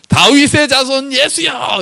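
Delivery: high, drawn-out acted shout; crying out to Jesus. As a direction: call out desperately to someone passing by. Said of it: "이렇게 소리 질러요". (높고 길게 외치는 소리)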